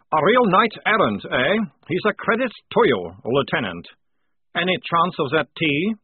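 butter up the officer jovially; flattering sergeant. A narrator's reading. Prisoner complimenting the lieutenant then asking for tea, flattering.

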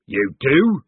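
disbelieving corporal; incredulous. Acted.

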